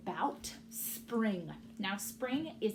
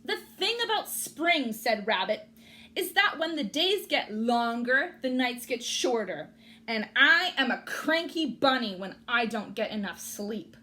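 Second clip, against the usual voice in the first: cranky bunny; 2 semitones higher, 7 dB louder than usual. Acted. Rabbit cranky.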